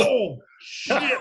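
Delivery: high, loud "oh shit"; loud reaction. Retold or imitated. Watching the cop hit the cabinet, loud exclamation. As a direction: burst out at the crash.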